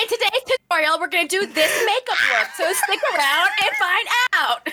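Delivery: bright, upbeat makeup tutorial host; chirpy beauty vlogger opening a video; YouTuber intro voice. Retold or imitated. You just hear a YouTube video start from the other room.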